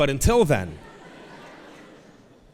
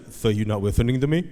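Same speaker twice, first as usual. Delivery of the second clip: imitated lisped reproach; lisping four-year-old; 3 semitones lower than usual. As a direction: question dad as a lisping little boy. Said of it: "he has a lisp right now".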